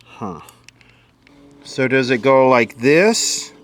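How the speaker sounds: normal talking voice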